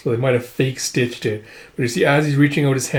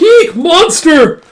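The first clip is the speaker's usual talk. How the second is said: high, drawn-out mock shriek of horror; frightened scream; 9 dB louder, much slower than usual. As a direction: shriek like a horrified maid. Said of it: "she screams".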